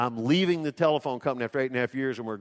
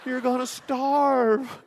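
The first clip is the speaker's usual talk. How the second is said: high, slow, wailing repeat of the warning; a mother's tearful plea, stretched out and pitched high; crying mom; 12 semitones higher, 5 dB louder, much slower than usual. Retold or imitated. Mother hears son is leaving his job, high slow crying plea. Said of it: "She starts crying".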